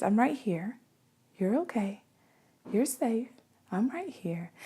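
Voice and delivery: put-on barely there, calming a crying baby; gentle hush. Shown as a demonstration. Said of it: "I speak in that same way".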